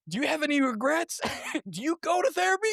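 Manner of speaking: high-pitched comic interrogation, laughing; hammed-up urgent asking, close to laughter; questioning while fleeing. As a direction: throw frantic mock questions over your shoulder. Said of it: "I'm turning around asking him questions".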